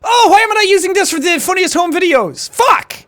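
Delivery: high-pitched exclamation building to a shouted curse; exasperated outburst. Real, not acted. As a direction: cry out in comic frustration. Mock regret at a missed joke.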